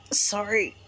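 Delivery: pained from heartburn. Real, not acted. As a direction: wince through heartburn pain. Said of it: "Only way I can get rid of heartburn".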